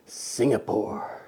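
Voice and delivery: slow breathy whispered Singapore; pretend baby answer. Dad voicing his baby's reply, slow and breathy. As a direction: answer as the child, drawn out.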